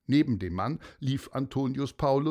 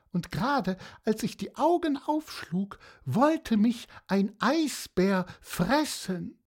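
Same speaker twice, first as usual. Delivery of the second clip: a hint of a chatty seahorse telling tall tales; storyteller slipping into the seahorse's rambling voice; lightly voiced seahorse; 11 semitones higher than usual. Seahorse rambles to Pieper about its adventure, lightly voiced.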